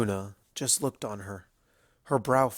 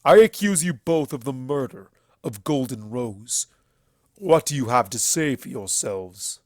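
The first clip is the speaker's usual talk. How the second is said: accusing stallion; 6 dB louder than usual. A narrator's reading. Everfree accuses the sisters of murder, loud and stern. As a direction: thunder the charge at them.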